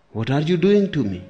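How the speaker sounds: imitating a listener's loud protest; acted indignant question; annoyed complaint. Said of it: "Don't get annoyed and don't say".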